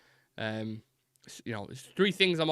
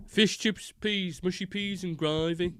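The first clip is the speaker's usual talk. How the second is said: high, drawn-out Brummie imitation; Brummie accent; 6 semitones higher, slower than usual. Recalling the donut seller's chippy order, strong Brummie accent. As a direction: savour each item in exaggerated Birmingham tones. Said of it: "I always think about that".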